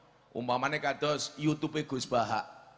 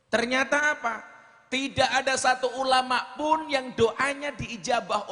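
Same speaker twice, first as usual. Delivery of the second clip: rising preaching emphasis; 9 semitones higher, 3 dB louder than usual. Acted. Preacher builds suspense in story.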